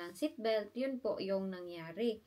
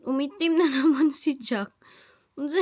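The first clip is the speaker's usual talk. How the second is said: laughing; 6 semitones higher, 9 dB louder than usual. Real, not acted. Laughing at baby.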